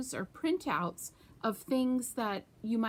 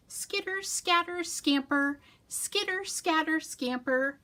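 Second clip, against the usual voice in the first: squirrel voice; 6 semitones higher than usual. Acted. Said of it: "she said".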